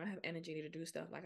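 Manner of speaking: normal talking voice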